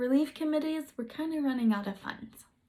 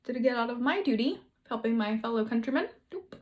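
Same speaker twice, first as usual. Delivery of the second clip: usual speaking voice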